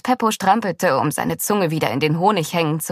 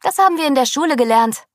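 higher, quick, eager voice; 6 semitones higher, 3 dB louder, faster than usual. A narrator's reading. Tameo shares what school taught him, eagerly. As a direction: rattle it off eagerly. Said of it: "rief Tameo eifrig".

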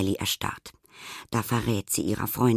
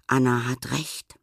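plain reading voice

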